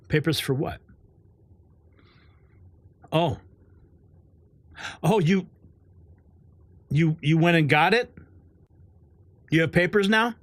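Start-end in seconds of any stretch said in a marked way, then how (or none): 3.1-10.3 mock surprise. Sarcastic reaction to vaccinated viewer.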